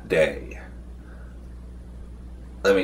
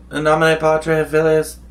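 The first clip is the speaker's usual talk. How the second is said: raised, solemn Latin blessing chanted a touch high; mock prayer; 8 semitones higher, 4 dB louder than usual. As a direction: intone it like a hammed-up priest. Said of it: "I say a prayer".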